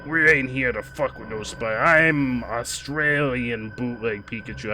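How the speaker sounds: hammed-up Australian that lands vaguely Brooklyn; bad Australian accent. Streamer mocking their own attempt, bad Australian accent. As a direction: stretch out a badly faked Australian accent. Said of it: "all my accents are just vaguely Brooklyn".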